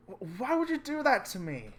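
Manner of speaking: loud, high, whining complaint; whiny high-pitched protest. Acted. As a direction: wail it like a wronged kid. Victim whines after food is stolen.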